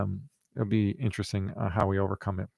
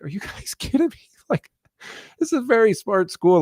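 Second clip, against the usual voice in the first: much higher incredulous voice with a laugh; laughing, exasperated mock rebuke; mock disbelief; 10 semitones higher than usual. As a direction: playfully scold the kids, pitch way up. Scolding kids in disbelief.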